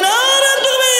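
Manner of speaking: very high-pitched rallying cry; forceful takbir call. Real, not acted. Preacher urging a stronger takbir from the crowd, high forceful cry. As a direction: push the slogan right up high to rouse them. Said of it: "জোর দিয়ে বলি".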